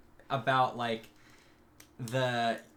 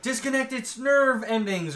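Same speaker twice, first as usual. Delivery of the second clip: high, loud mock emergency order; urgent command; 10 semitones higher, 5 dB louder than usual. Scientist as the test goes wrong, urgent command. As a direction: call it out in alarm.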